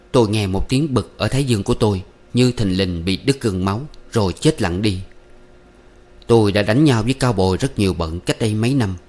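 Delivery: ordinary delivery, nothing marked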